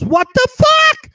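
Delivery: imitating a high-pitched alarmed woman; panicked woman. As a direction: squeal it like a shocked woman. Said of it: "and the woman goes".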